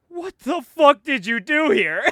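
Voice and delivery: high, slow, loud disbelief; shocked Flash. Retold or imitated.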